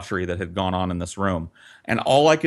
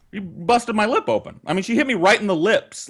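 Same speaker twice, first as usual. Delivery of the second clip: ordinary talk, nothing marked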